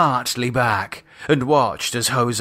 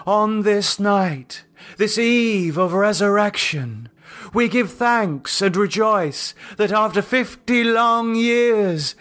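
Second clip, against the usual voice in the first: cult leader Lydia; 7 semitones higher than usual. Acted. Cult leader addressing the circle, solemn and raised. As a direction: intone it like a high priestess. Lydia's ritual speech voiced.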